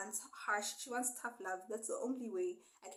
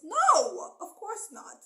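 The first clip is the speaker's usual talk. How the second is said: high, loud No, breaking out; retelling a crying woman yelling in court; retold outburst; 11 semitones higher, 15 dB louder, much slower than usual. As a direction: burst out with the No like she's losing it. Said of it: "But Hera lost it".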